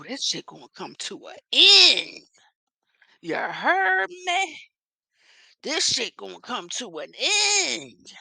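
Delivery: slow, drawn-out melodramatic imitation; hammed-up outrage, stretching the key words with big swoops; mock defiant declaration. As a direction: declare it theatrically, pausing between phrases. Acts out Angela's defiant declaration.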